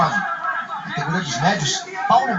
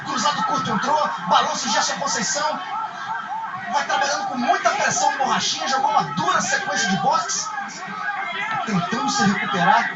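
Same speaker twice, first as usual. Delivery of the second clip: higher, rising with the action; excited play-by-play; 7 semitones higher than usual. Acted. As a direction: call the flurry with urgency. Play-by-play of fighter flurry.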